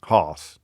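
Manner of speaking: "Harse" low, with a long ah; estuary vowel. Shown as a demonstration. Host practising estuary house, low "Harse" with long ah. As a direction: drop the pitch on a long-ah "Harse". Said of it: "house becomes harse".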